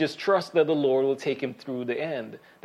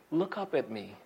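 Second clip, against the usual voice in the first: tender parent; 4 semitones lower, 9 dB quieter than usual. Retold or imitated. Parent lifting child's chin.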